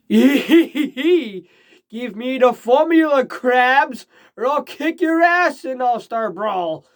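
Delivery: slow, high villain demand through laughter; Plankton voice. Pretend. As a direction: drag out the threat like a little villain. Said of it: "I can't do Plankton's voice".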